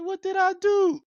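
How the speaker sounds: high protest. Retold or imitated. Son to his stepmother, high pleading protest. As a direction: squeak it out, wounded.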